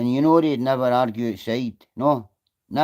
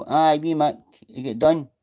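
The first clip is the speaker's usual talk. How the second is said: ordinary talk, nothing marked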